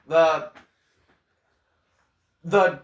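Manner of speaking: sputtering after swallowing water wrong; choking a little mid-sentence. Real, not acted. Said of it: "sorry, I'm choking on water a little bit".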